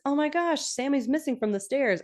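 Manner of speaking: high-pitched mock alarm; Lorelai. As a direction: act out a surprised discovery. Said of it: "And Lorelai says".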